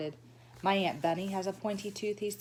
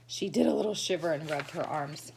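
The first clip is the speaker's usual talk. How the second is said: plain reading voice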